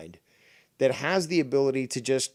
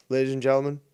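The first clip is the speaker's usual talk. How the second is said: normal talking voice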